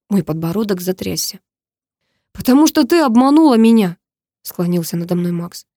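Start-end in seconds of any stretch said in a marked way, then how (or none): 2.3-4.0 higher, loud accusation; angry retort. A narrator's reading. Max hurls the blame back at Karina, loud angry retort. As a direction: throw the blame back forcefully.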